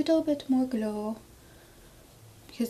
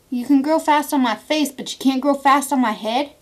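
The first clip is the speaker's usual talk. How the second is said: mock scolding; 3 semitones higher, 8 dB louder than usual. Scolding stray hairs.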